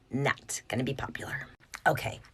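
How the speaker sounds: conspiratorial whisper. Acted. Parent whispering a warning to other parents. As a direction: lean in and whisper the secret.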